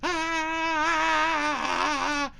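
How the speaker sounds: long high shriek of delight; excited scream. Real, not acted. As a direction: hold a thrilled yell. Screams along with the song.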